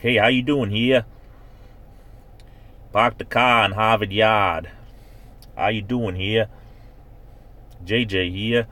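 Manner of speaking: hammed-up Boston street greeting; Boston accent. Shown as a demonstration. Showing viewers how Boston talks, Boston accent. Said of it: "around the Boston, Massachusetts area where things a little bit more like this".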